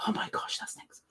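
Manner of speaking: hushed, unvoiced whisper to self; barely audible aside. Real, not acted. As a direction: whisper it under the breath. Aside to self while reading chat.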